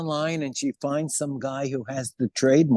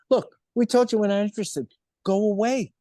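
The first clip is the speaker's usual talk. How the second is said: raised-pitch, impatient dismissal; mock brush-off; 6 semitones higher than usual. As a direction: imitate executives brushing her off. Acted rejection by Sony executives.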